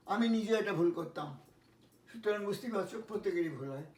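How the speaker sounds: ordinary delivery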